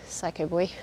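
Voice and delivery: soft, low aside to the dog; quiet teasing to the dog; fond mutter to dog. Real, not acted. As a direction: murmur affectionately at the dog. Fond remark to own dog.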